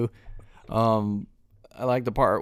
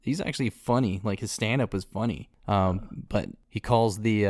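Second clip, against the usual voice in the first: ordinary delivery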